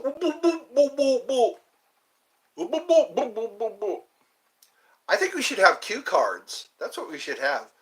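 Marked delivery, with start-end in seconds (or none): 0.0-4.0 high playful boop sounds while pointing. Shown as a demonstration. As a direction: bop out silly high boops, ending in a laugh. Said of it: "Just point to your mouth".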